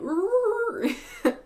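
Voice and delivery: high burst of real laughter; a sudden high laugh. Real, not acted. Laughs at own predicament.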